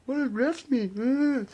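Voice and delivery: high, slow dopey mock question; mocking clueless readers. Mocking people who ask what refs means, high slow voice. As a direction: play dumb, slowly and high. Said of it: "'cause they're like".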